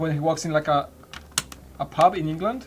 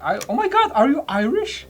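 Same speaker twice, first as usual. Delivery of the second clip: put-on excited drunk woman; 8 semitones higher, 5 dB louder than usual. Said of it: "some drunken approached him".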